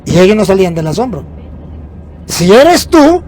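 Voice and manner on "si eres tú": unsure Peter, high and loud. Retold or imitated. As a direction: raise the voice high on "Si eres tú", loud and searching. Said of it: "no estaba muy seguro".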